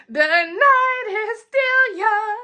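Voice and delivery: loud, high, slow proclamation; dramatic declaration. Pretend. Telling listeners the night's not over, loud and slow. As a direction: belt it out slowly like a toast.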